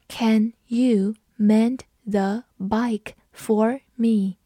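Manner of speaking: slow, careful read of the sentence. Shown as a demonstration. Teacher reading slowly for students to follow. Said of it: "我们慢读一遍".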